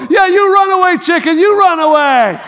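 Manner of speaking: loud, high mocking holler; taunting neighbor kid. Chucky calling after the boy running away, loud taunt. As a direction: imitate a boy yelling after a runaway. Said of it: "I can hear him go".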